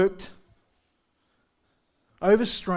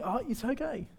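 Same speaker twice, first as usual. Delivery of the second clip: much softer, light, unbothered reply; calm and cool; 3 semitones higher, 11 dB quieter than usual. Retold or imitated. Pastor reassures a sorry nurse, soft and calm. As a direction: brush off the nurse's apology gently. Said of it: "How come you're so cool, you're so calm?".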